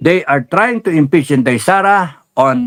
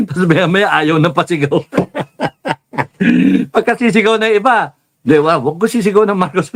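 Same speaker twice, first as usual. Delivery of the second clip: laughing; 6 semitones higher than usual. Real, not acted. Laughing while retelling protester.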